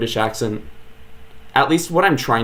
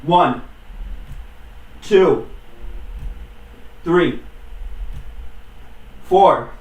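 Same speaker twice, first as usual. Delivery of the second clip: counting push-ups; 5 semitones higher, 3 dB quieter, much slower than usual. Real, not acted. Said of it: "I'm feeling another ten push-ups".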